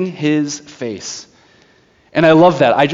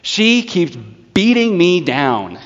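higher, slow weary complaint; exasperated judge; 5 semitones higher, slower than usual. Retold or imitated. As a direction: moan it like a beaten man. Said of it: "it says that".

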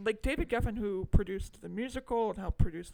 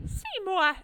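higher, louder Audrey-style call; calling Seymour; 10 semitones higher, 5 dB louder than usual. Retold or imitated. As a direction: call his name like Audrey does.

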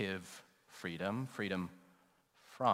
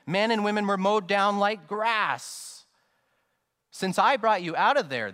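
loud, high-pitched sermon delivery; big, raised pulpit voice; impassioned preaching; 9 semitones higher, 8 dB louder than usual. Real, not acted. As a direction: preach it out with raised, urgent force.

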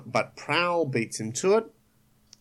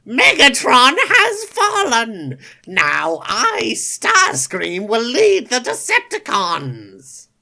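high, loud, slow villain proclaiming; Starscream voice; 10 semitones higher, 9 dB louder, slower than usual. Acted. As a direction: crow it out as the new tyrant. Starscream proclaiming himself leader.

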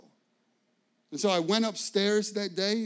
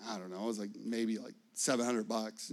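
sheepish mumble; 7 semitones lower, 5 dB quieter than usual. Retold or imitated. Unsure answer about refund.

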